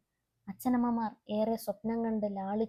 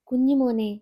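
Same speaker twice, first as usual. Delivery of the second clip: 1 loving address; 3 semitones higher, 8 dB louder than usual. Acted. Mother's letter opening.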